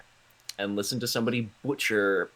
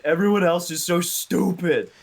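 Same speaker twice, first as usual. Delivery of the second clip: high, loud cartoon whine; Homer Simpson; 9 semitones higher, 6 dB louder than usual. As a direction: imitate a cartoon dad's exasperated complaint. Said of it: "Homer Simpson laying on the couch saying".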